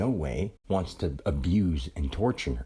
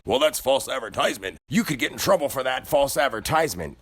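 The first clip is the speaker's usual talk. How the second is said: a hint of an indignant shopper, a touch higher; mimicking huffy customer; 4 semitones higher than usual. Retelling the customer complaining about false advertising, lightly mock indignant. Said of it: "they're still like".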